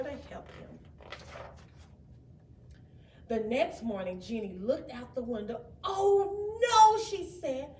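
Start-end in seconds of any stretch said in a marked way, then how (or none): 5.8-7.0 worried dismay. Acted. Jenny sees rain, fears for Frosty.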